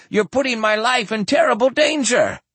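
high, panicked plea; begging. Acted. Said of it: "he begged".

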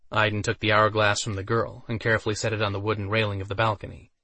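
usual speaking voice

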